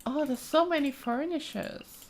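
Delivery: ordinary talk, nothing marked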